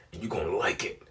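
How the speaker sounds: imitating her seductive whisper; hushed mock seduction; whispered come-on.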